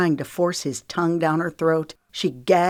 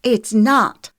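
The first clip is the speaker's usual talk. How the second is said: raised, emphatic contradiction; shouted; 6 semitones higher, 7 dB louder than usual. A narrator's reading. Lexi contradicts Wiley, shouting. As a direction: shout the denial. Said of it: "Lexi shouted".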